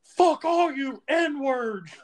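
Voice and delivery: high, drawn-out whiny yell; mock crying yell. Joe coming out humiliated, mock crying yell. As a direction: imitate someone yelling through tears, slowly. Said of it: "crying, he goes".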